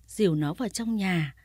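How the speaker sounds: ordinary delivery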